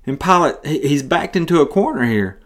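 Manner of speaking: ordinary delivery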